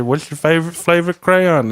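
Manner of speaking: high dopey yokel voice. Pretend. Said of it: "he sounded like an Earl".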